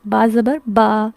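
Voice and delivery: "با" drawn out with a long open aa; teacher modelling the fatha sound; slow vowel demo. Shown as a demonstration. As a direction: slowly sound out "با زبر با". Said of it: "اس میں آ کی sound add ہو جاتی ہے".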